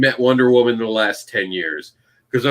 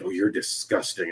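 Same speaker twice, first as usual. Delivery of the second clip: imitated critic, lower and softer; hushed disgust; 5 semitones lower, 9 dB quieter than usual. Stranger to a vendor, muttered disgust. Someone insulting the seller.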